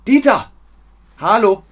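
loud, high hail to Dieter; calling out. Shown as a demonstration. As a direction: call to someone across the street. Calling out a greeting, acted example. (lautes, hohes Rufen)

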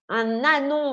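« Un ânon » avec liaison, « un-nânon ». Shown as a demonstration. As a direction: fais bien sonner le n de « Un » sur « ânon ». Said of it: "on fait la liaison". (liaison appuyée dans « Un ânon »)

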